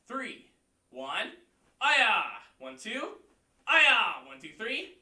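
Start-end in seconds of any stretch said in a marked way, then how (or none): 1.8-2.2 sharp, loud martial arts shout; loud kiai. Shown as a demonstration. Instructor leads the class through the form: loud kiai. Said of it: "you key up". 3.7-4.3 sharp, loud martial arts shout; loud kiai. Shown as a demonstration. Instructor leads the class through the form: loud kiai. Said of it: "you key up".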